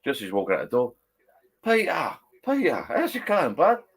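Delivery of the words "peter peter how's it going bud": high, slow, drawn-out call to a mate; hearty greeting. Retold or imitated. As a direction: sing out a friend's name across the pub. Mate greets him in pub.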